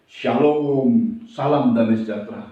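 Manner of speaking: low, measured greeting; Lord greeting disciples. Acted. Risen Lord suddenly greets grieving disciples, composed greeting. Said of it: "Tuhan tiba-tiba datang".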